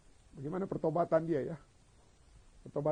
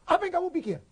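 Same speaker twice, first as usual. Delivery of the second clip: high-pitched, raised-voice retort; indignant challenge; 13 semitones higher, 4 dB louder, faster than usual. Acted. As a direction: fling it back as an indignant challenge. Acting Peter's heated denial. (bantahan sengit bernada tinggi)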